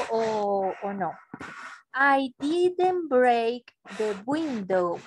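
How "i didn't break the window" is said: slow, each word spaced out; slow careful model sentence. Shown as a demonstration. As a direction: say it slowly for the student. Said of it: "Very good". (despacio y claro)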